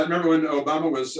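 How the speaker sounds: usual speaking voice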